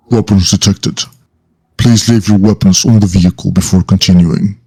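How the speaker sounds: cold robotic security voice; flat machine announcement, lightly voiced; metallic voice filter. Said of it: "a cold metallic voice going like".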